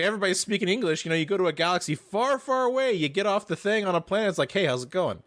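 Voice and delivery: Bill Burr. Retold or imitated. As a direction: rattle it off like a stand-up bit, climbing high on "far away". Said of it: "He's like".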